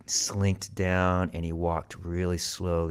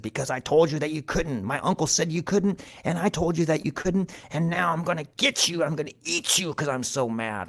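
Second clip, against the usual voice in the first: higher, indignant scolding cat; mad cat; 8 semitones higher than usual. A narrator's reading. Cat threatens to eat Mouse, high-pitched angry scolding. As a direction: build a huffy tirade into a threat. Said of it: "Mouse had never seen Cat so mad".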